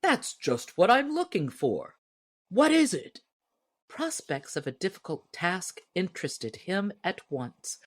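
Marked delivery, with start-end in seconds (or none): 0.0-3.2 rising high and loud, keen; eager question. Acted. Paul asking the deacon about the job, eager. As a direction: jump at the job.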